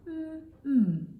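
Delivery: humming the M sound, high and loud. Shown as a demonstration. Teacher modelling the M sound for pupils. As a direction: hold a clear mm for the class. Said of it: "teacher sounding out the letter".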